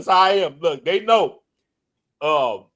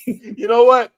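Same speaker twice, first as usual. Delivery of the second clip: ordinary delivery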